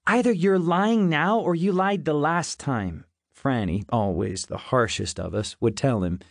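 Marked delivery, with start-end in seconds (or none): 0.1-3.0 high-pitched harsh accusation, not shouted; shrieked accusation. A narrator's reading. Harsh daughter challenging father's changed story, mildly shrieking. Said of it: "we would shriek at him".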